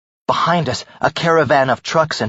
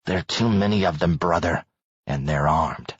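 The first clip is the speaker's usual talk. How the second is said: low, much softer, through teeth; through gritted teeth; 6 semitones lower, 5 dB quieter than usual. Acted. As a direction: hold back anger, barely voiced. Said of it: "Nick gritted his teeth".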